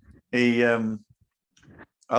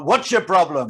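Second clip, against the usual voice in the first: louder and higher, confronting; retold angry shout; 6 semitones higher, 5 dB louder than usual. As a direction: bark the challenge at the driver. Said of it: "Straight over to his car".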